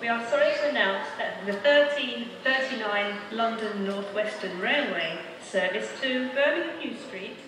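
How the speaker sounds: normal talking voice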